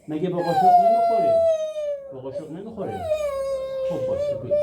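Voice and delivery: high coaxing voice. Real, not acted. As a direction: coax him in a high voice. Father coaxing crying son, high voice. (صدای زیر و نازکِ نوازشگرانه)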